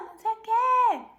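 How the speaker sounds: calling out loud and high; shout. Shown as a demonstration. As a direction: call it across a field. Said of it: "Shout é quando você grita".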